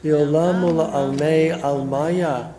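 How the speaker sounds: slow, measured prayer recitation; Kaddish recitation. Acted. Rabbi leading mourners in the Kaddish, slow prayer chant. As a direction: lead the mourners through the Kaddish, unhurried.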